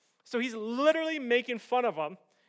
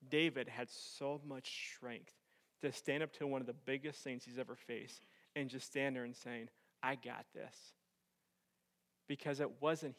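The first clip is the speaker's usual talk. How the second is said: solemn hushed preaching; 10 semitones lower, 11 dB quieter than usual. Real, not acted. Preacher telling congregation the battle is the Lord's, solemn hush. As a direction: bring it right down, quiet and weighty.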